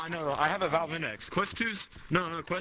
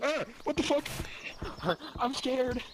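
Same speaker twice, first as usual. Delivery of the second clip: startled; 6 semitones higher, much slower than usual. Real, not acted. Startled by something in game.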